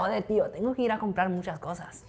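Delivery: mock Spanish-from-Spain speech; lightly put-on Spain Spanish accent. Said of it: "Like this".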